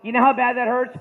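usual speaking voice

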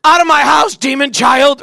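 high, loud, hammed-up shriek; mock furious mom. Mom throwing out her child, mock furious shout. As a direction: banish them with a theatrical shout. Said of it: "she's like".

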